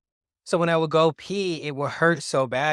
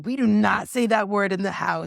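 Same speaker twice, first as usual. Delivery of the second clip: higher, louder mock scold; scolding mom; 6 semitones higher, 5 dB louder than usual. Mom scolding son about a word, mock stern. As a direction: play the strict mom laying down a rule.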